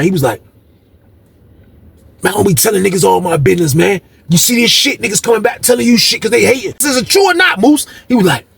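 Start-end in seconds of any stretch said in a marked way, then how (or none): 2.2-7.9 heated and fast. Retold or imitated. Heated argument with cellmate.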